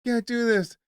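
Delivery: high squeaky mock plea; cartoon pleading. Cartoon Lowry begging Masai, high mock plea.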